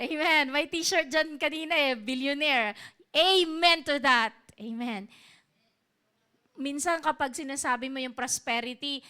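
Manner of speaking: ordinary talk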